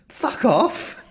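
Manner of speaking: high, loud laughing fuck off; imitating a stunned, giggling reaction; retelling the fiancée's shocked laugh at the proposal; laughing disbelief.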